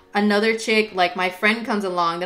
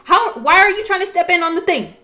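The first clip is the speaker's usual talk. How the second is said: high, loud confrontation; retold indignant outburst; 9 semitones higher, 5 dB louder than usual. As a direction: call out a rival, pitch jumping up. Acted quarrel with friend.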